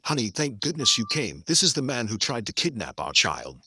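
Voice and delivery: ordinary delivery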